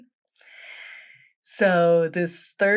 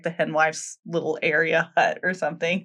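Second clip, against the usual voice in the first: ordinary talk, nothing marked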